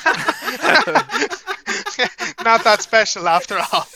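loud laughing burst. Real, not acted. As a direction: laugh through the words. Laughing at own joke.

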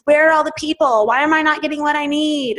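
half-joking tantrum at nobody; hammed-up frustrated outburst; mock temper tantrum. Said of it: "I throw this little temper tantrum".

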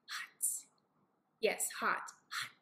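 hushed, breathy hot; soft whispered caution to a little one; whispered warning. Shown as a demonstration. Mother warning child about bulb.

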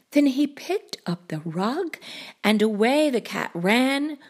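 usual speaking voice

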